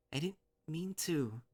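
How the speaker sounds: high, slow, tearful apology; upset, halting and drawn out; faltering confession. A narrator's reading. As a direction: falter upward as if about to break off. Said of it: "goes into a coughing fit".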